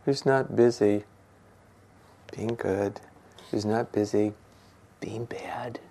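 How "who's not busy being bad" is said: soft whispered echo of the line before. Shown as a demonstration. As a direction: drop to a near whisper on being bad. Repeats the phrase, second time whispered.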